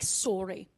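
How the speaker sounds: "sorry" with a big rounded O, SOR-ee; Canadian rounded O. Shown as a demonstration. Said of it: "with a big old rounded O".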